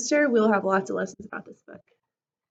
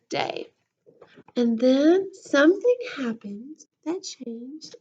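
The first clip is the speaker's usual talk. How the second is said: lively storybook voice, big swings of pitch; reading to children; 7 dB louder, slower than usual. A narrator's reading. Teacher reading a book to her class, lively storybook voice. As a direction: read it to the class like a picture book.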